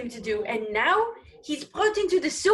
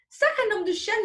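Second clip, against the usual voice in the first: much higher, louder French curse; outraged oath; 9 semitones higher, 4 dB louder than usual. Acted. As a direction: spit out the oath in disbelief. Sailor swears in outrage.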